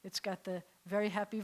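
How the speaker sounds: normal talking voice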